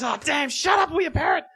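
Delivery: high exasperated outburst at the parrot; high mock-angry shout at a tattling parrot; annoyed magician.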